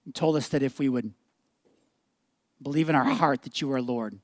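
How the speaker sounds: low, hushed pastoral prayer. Real, not acted. Pastor leading the church in closing prayer, reverent. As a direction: pray gently with long pauses.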